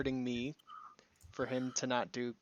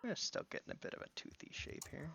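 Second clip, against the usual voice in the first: unvoiced whisper while concentrating; whispering to himself; 3 semitones higher, 8 dB quieter than usual. Real, not acted. Working alone on the tooth model, whispered self-talk. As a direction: murmur the step under your breath.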